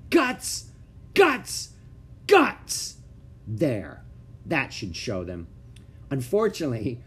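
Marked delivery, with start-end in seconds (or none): 0.1-3.0 very high, loud yell. Acted. Ramona yells the bad word at her family. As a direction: scream the word at them. She keeps yelling the word.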